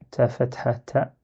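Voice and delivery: ordinary delivery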